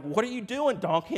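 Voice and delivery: high, loud scolding yell; exasperated Balaam. Acted. Balaam to his balking donkey, exasperated yell. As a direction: demand an explanation, voice jumping up loud. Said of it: "And Balaam was like".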